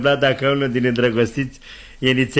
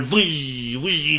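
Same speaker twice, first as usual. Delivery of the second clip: imitated whooshing gusts; howling wind; 3 dB quieter than usual. Storyteller imitates wind drowning Scott's calls, long whooshes. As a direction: whistle like wind in a storm. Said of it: "Și vântul".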